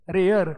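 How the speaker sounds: put-on रेयर with a hard rolled r, the way Marathi-medium kids say it; Marathi-medium pronunciation. Shown as a demonstration. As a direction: say रेयर as reh-yer, hard r at both ends. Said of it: "हमारे जैसे Marathi medium के बच्चे क्या बोलेंगे?". (रेयर को Marathi medium वाले उच्चारण में, कड़े र के साथ)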